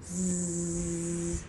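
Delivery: soft, sustained buzz; bee buzzing. Pretend. Mother showing baby the bee, soft buzzing. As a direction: imitate a bee buzzing softly.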